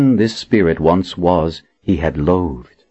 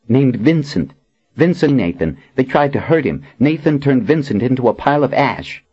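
higher, quick admiring voice; eager young woman; 8 semitones higher than usual. A narrator's reading. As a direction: gush about him breathlessly fast.

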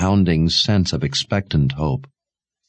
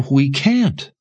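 higher, insistent protest; insist, pitched up; 8 semitones higher than usual. A narrator's reading. Mother protesting to Sebastian that they can't, insistent. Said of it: "she insisted".

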